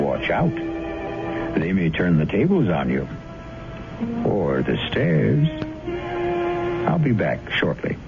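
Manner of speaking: usual speaking voice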